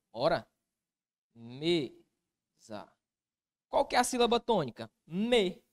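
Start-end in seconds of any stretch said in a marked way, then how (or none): 1.3-2.9 MESA in two syllables, "me... sa", long gap between; split into syllables. Shown as a demonstration. Teacher splitting the word for the class, syllable by syllable. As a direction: say "mesa" slowly as two separate beats. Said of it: "vamos separar a sílaba?".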